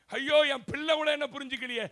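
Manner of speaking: loud retold wail of grief; wailing cry. Mother wailing that even her son doesn't understand, loud. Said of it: "கதறாவ".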